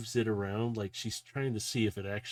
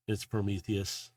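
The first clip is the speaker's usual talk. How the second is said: normal talking voice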